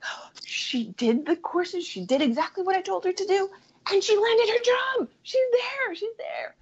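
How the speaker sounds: high, gushing reenactment; retold excitement. Coach reporting her client landed the job, thrilled. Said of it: "this is so exciting".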